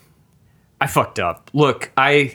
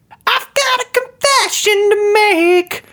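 dramatic confession; 3 dB louder, slower than usual. Acted. Sketch, dramatic confession to friend.

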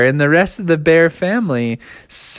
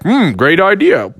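Papa Bear voice; 2 semitones higher, 3 dB louder than usual. Acted. Papa Bear voiced in bedtime story.

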